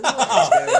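put-on a bewildered girlfriend, loud and laughing through it; laughing loud quote. Girlfriend back from the toilet finds him with strangers, laughing loud. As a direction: burst out with it, laughing. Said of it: "was like".

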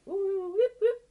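high musical droid squeal; a startled robot chirp. Acted. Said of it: "You practiced that".

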